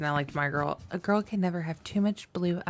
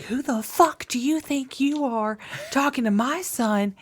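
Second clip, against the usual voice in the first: angry mom; 8 semitones higher than usual. Retold or imitated. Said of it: "this actual mom gets on".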